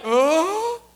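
high imitated shocked "ohh"; long rising crowd reaction; crowd gasp "oh". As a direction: mimic a crowd's stunned "oh". Crowd reacting to shovel hit.

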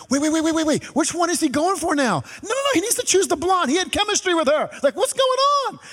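high, excited mock outrage; hooked on the show. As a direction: squeal it like a fan hooked on the drama. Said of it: "and I'm like".

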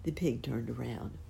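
ordinary delivery, nothing marked

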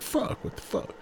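quiet, breathy curse under the breath; hushed exasperated aside; muttered disbelief. Retold or imitated. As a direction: mutter it to yourself, baffled. Retelling his frustration at texting.

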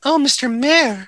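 swooning. Acted. Player swoons at the mayor.

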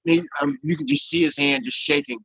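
ordinary delivery

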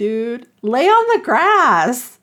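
drawn-out "come on" disbelief; exasperated plea. Retold or imitated. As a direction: stretch each word in mock exasperation. Exasperated advice to story characters.

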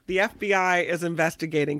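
ordinary delivery

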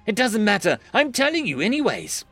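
bubbly and high-pitched; eager high voice. Acted. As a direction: gush it out eagerly. Jamie eager to tell.

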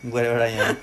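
laughing through the words. Real, not acted. Repeats own words while laughing.

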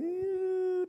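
long, high-pitched squeal of disgust. Acted. Sister at the opened tomb, high disgusted squeal. Recoiling from the tomb's stink.

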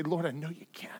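soft, unvoiced whisper of a desperate plea. Leper whispering to Jesus, begging to be made clean. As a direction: whisper it like a beggar on his knees.

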